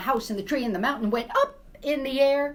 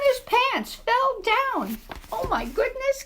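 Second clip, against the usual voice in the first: slow, much higher gasp of shock; milk the surprise as the pants drop; telling kids the pants fell down, much higher and slower; 3 dB louder, slower than usual. Acted. Pants fall, shocked narrator.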